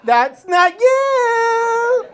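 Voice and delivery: loud, high, drawn-out singsong; mocking phone voice. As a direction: sing out a long, taunting rejection. Acts iPhone rejecting his face.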